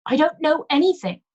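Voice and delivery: high, loud shrill denial; shrill it out in panic; shrill, loud repeat of the denial. Acted. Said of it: "she repeated shrilly".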